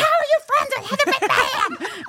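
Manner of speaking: very high, incredulous mock screech; imitated fan scream. Fan questioning Heather's friend from her car, mock screaming. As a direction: squeal the whole question like a stunned superfan. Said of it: "but screaming, you know".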